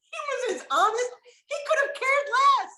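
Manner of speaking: high, tearful voice breaking into real crying; laughing it off until the tears come through. Real, not acted. Remembering dad's reply to the big news, really crying.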